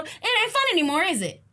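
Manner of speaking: ordinary delivery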